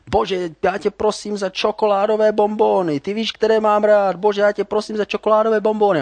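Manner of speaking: put-on high, loud yelled prayer of a little boy; child shouting a prayer. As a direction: bellow the prayer like a kid who wants to be heard next door. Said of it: "začal strašně křičet a křičel".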